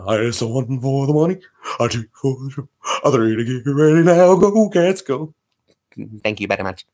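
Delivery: rock-and-roll crooner voice counting in. Acted. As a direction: croon it like the King. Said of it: "now I will do for you the [name]".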